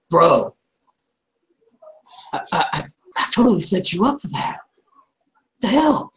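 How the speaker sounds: high-pitched, stammering bro complaint; indignant protest. Acted. Player voicing a let-down bro to his buddy, indignant. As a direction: protest in disbelief, voice jumping up high.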